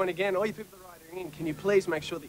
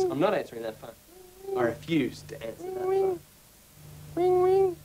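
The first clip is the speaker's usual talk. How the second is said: high, whiny complaining rant; whiny tantrum; 13 semitones higher than usual. Acted. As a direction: sulk like a cut-off host. Host's whiny comic rant.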